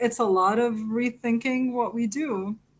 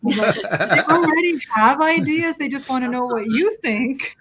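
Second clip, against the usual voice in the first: high, loud, laughing as you talk; 4 semitones higher, 5 dB louder than usual. Real, not acted. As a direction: talk through real amusement, voice lifted. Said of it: "I'm laughing".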